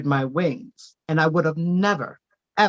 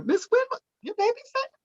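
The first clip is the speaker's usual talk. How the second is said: neighbour asking. Retold or imitated. Neighbour's question voiced in story.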